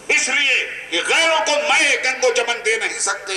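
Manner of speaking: high-pitched, declaimed patriotic couplet; ringing mushaira-style delivery, pitched high; rousing verse recitation. Real, not acted. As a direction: declaim Urdu poetry to a rally crowd.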